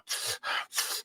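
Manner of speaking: exhaled cigarette puff; imitated drag and exhale; smoking puff. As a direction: blow out smoke from a cigarette. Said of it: "he was smoking a cigarette, doing the".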